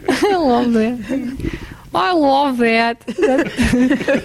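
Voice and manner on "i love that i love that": slow, drawn-out imitation of Brandy; Brandy impression. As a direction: drag each word out like Brandy. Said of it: "that's how Brandy speaks".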